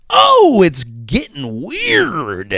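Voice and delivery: high wail dropping into a slow drawl; loud high "oh", then draw out the last word; mock alarm. Comic reacting to covert government payments, mock alarm.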